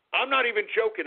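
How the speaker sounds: normal talking voice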